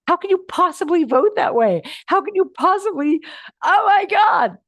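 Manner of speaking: high, loud exasperated rant building up; rising incredulous outburst at the other voter; mock outraged disbelief. Teacher voicing the inner voice, exasperated at other voters, mock outraged. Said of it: "some little piece of you that's like".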